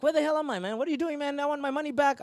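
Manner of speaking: high-pitched acted protest; indignant protest. Waking in the arena, demanding money back, indignant.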